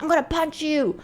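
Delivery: high-pitched imitation of his threat; mock threat. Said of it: "he still continued saying".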